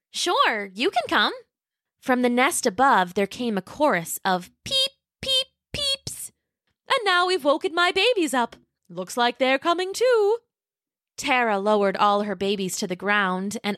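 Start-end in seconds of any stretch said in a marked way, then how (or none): 0.1-1.3 put-on high, sweet little dinosaur girl voice; Roxy the young T-Rex. Roxy agrees. 4.6-6.3 high, tiny peeps; baby chicks peeping. Acted. Baby pterodactyls chirp. 6.9-10.4 matronly pterodactyl. Acted. Tara sighs.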